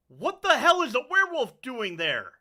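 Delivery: loud, high shout of alarm; yelling. Acted. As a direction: yell it up from below. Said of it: "yell out".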